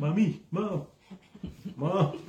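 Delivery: hammed-up little boy calling for mommy; crying child. Boy calling for his mom as she walks off, mock crying. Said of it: "Ishmael's crying too".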